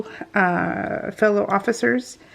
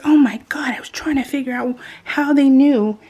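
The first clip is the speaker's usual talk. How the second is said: higher, louder retold exasperation; mock-dismayed realization; exasperated; 5 semitones higher, 4 dB louder than usual. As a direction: imitate an exasperated daughter.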